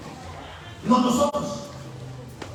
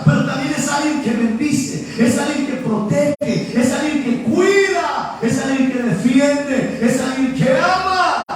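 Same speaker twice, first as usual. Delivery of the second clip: impassioned preaching; 2 semitones higher, 4 dB louder, slower than usual. Real, not acted. Preacher tells congregation who blesses and protects, impassioned and building. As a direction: stretch each clause out to a ringing finish.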